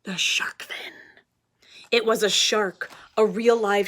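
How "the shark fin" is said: whispered reveal. Acted. Whispered reveal of shark fin.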